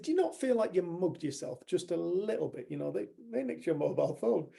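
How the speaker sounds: teasing disbelief. Retold or imitated. Dave teasing the woman who forgave her neighbors, incredulous. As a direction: poke fun at her forgiveness.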